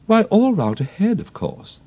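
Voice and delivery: high, prim flower voice; a proper lady of the garden; prim Rose. Acted. The Rose chats to Alice, high and prim.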